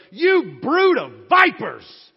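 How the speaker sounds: booming fire-and-brimstone denunciation; fiery prophet shout. Acted. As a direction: blast them like an angry prophet. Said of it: "And John looks up and goes".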